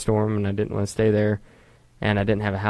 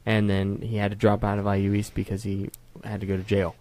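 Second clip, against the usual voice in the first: ordinary talk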